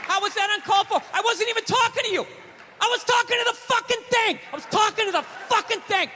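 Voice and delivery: high, heated quarrel with a partner; acted argument. Man arguing with girlfriend over furniture, high heated voice.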